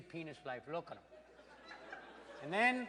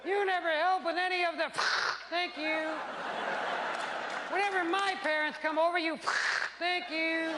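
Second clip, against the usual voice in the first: mock wife voice; 9 semitones higher than usual. Wife thanking the dollar man, high mock voice. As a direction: squeak it like a placated wife.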